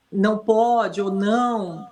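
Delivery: firm command not to; a mildly stern prohibition to a dog; nada tegas. Shown as a demonstration. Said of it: "com outra entoação".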